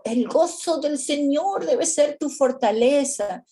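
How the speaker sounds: "gozo" punched in a mock preachy, challenging tone; turning it back. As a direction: throw the verse right back at them. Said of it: "A ver si yo le digo".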